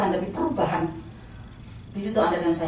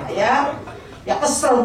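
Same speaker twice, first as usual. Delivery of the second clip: usual speaking voice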